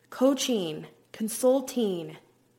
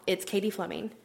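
ordinary talk

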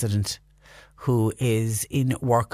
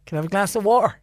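higher, put-on Dublin accent with the T in "water" dropped; 7 semitones higher, much faster than usual. Shown as a demonstration. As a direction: order a glass of water like a Dubliner. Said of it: "is that Dublin".

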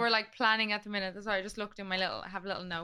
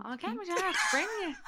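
ordinary talk, nothing marked

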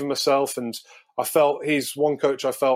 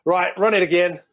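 higher, louder easygoing coach call; breezy coach impression; 5 semitones higher, 4 dB louder than usual. Retelling relaxed walkthroughs, higher louder easygoing coach voice. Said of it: "you'd just be like".

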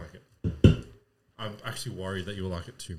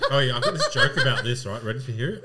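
loud and laughing; giggly teasing, louder; 2 semitones higher, 9 dB louder than usual. Real, not acted. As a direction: tease through giggles. Teasing a joke.

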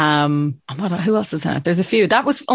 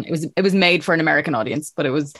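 usual speaking voice

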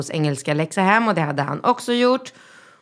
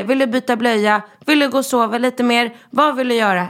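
retold plea from an exasperated parent, climbing higher; pleading parent; 6 semitones higher than usual. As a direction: plead with a small child, pitch rising as the options pile up. Parent pleading with crying child, retold.

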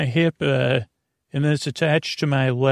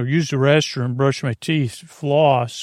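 ordinary talk, nothing marked

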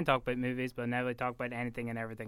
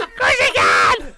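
loud, slow encouraging shout; excited shout; 5 semitones lower, 20 dB louder, slower than usual. Real, not acted. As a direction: yell it to pump him up. Cheering him on.

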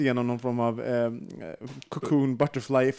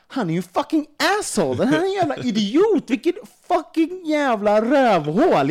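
much higher and louder, swearing in a furious rant; retold angry outburst; 11 semitones higher, 6 dB louder than usual. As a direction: relive the rage at Berger, spitting it out. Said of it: "Jag var så sur på honom". (ilsket utbrott, mycket högre och starkare)

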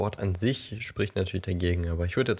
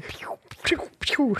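whizzing noises. Pretend. Imitating golf balls flying.

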